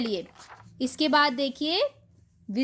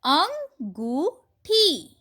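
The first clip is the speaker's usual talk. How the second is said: अंगूठी stretched very slowly and higher; slow drawn reading; 4 semitones higher, 3 dB louder, much slower than usual. Shown as a demonstration. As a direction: draw out अंगूठी syllable by syllable. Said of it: "चंद्र बिंदु से बने कुछ शब्दों". (अंगूठी को बहुत धीरे खींचकर पढ़िए)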